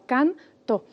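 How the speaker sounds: "canto" as can, to, separated; split into syllables. Shown as a demonstration. Teacher showing a two-syllable word, syllables apart. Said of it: "una palabra bisílaba".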